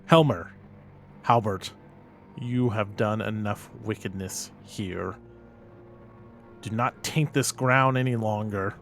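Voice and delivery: slow, formal in-character address; measured command, weighing each phrase; solemn commanding investigator. Acted. Investigator ordering possessed men to resist, solemn and commanding.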